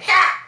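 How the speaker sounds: a single loud barked laugh; sharp fake laugh. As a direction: blurt out one loud laugh. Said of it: "sometimes you're just like".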